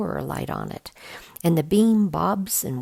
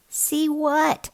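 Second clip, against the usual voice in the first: put-on much higher puzzled boy; confused kid asking; young boy voice; 12 semitones higher, 4 dB louder than usual. A narrator's reading. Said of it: "Mac asks".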